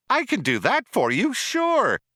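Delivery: high, loud eager computer; enthused. A narrator's reading. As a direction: gush it with machine glee. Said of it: "enthused the computer".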